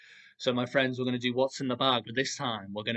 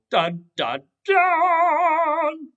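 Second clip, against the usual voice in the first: loud suspense sting, last note high and held; dramatic dun-dun-dun sting; 12 dB louder, much slower than usual. Pretend. Storyteller revealing a crocodile, mock suspense sting. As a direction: build to a big high final dun.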